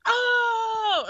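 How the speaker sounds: high, loud mock crowd "Oh!"; imitating a stunned crowd's cry; crowd gasp. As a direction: shout it like a whole shocked audience. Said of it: "the whole audience was like".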